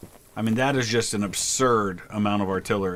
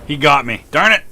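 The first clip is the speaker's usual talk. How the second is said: frustrated outburst; 3 semitones higher, 8 dB louder than usual. Real, not acted. Shot dead in game.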